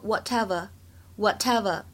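British "whatever" with no r at the end; British pronunciation. Shown as a demonstration. Teacher showing learners the British reading, British accent on "whatever". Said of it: "如果是读英式的读法".

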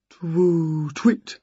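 drawn-out twoo with a short twit; owl hoot. A narrator's reading.

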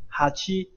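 teacher's model of 哈七 rising at the end; rising pitch accent. Shown as a demonstration. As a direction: step the pitch up from 哈 to 七. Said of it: "它是往上升的".

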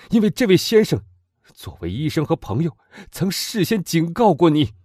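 put-on loud, high, fervent young man; impassioned young man. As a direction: demand revenge with burning passion. Morrel lists the murders. (激昂的青年)

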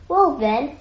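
a touch of irritation; cross. A narrator's reading. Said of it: "he said crossly".

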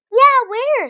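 very high, loud eager question. A narrator's reading. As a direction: ask it all excited. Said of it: "Sophie said".

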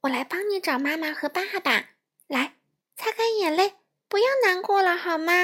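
high, quick reassuring fish voice; comforting little fish. Acted. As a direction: comfort a crying friend.

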